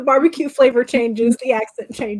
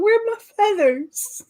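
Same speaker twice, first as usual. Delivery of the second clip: lightly voiced indignant chicken, much higher; startled chicken; 10 semitones higher than usual. Imagining a plucked chicken waking up, mock startled. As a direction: play a plucked chicken in high-pitched mock distress. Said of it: "Imagine waking up and going".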